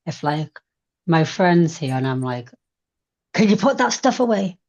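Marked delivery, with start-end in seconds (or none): 3.3-4.6 higher, sharp demanding request; mock irritated tone to a messy friend. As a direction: snap the order at your friend. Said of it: "in that tone of voice".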